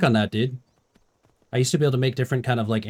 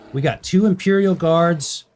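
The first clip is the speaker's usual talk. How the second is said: slow, louder mock fight commentary; sports commentary; 4 semitones higher, 4 dB louder, slower than usual. Streamer narrating guards fighting a bear, mock commentary. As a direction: call the fight like a ringside announcer.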